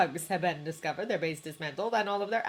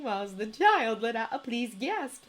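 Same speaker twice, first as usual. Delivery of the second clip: ordinary delivery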